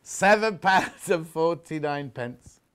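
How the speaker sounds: very slow, drawn-out price call, falling at the end; price announcement. Real, not acted. Seller repeating the price to viewers, very slow announcement. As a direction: stretch every word of the price right out.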